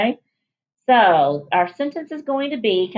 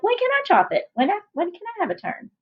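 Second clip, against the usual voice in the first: put-on eager kid asking; 10 semitones higher, faster than usual. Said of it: "And you're like".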